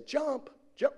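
high, coaxing parent's call; acted-out call to a child, pitched well up. Father urging his child to jump, high coaxing call.

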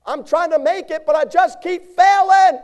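high loud complaining voice; high loud mock lament; whiny excuse. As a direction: mimic a churchgoer whining. Preacher voices congregant's excuse.

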